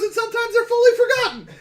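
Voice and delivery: very high, fast mock voice; squeaky brewer quote. Quoting the brewer in a high mock voice. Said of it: "He goes".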